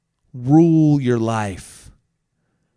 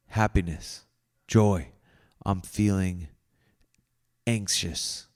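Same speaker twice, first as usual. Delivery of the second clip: guided reflection; 7 semitones lower, 5 dB quieter than usual. Acted. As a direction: talk yourself gently through your feelings, taking your time.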